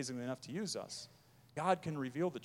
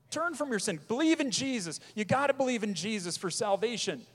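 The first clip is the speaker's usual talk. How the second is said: loud, high imploring plea; pleading; 7 semitones higher, 4 dB louder than usual. Retold or imitated. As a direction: beg them to turn and believe. Pleading with the unbeliever.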